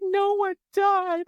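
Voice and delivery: high, slow crying wail, voice breaking; sobbing. Retold or imitated. Telling her husband nobody died, high slow sobbing. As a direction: wail it out slowly through the sobs. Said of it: "And I'm sobbing".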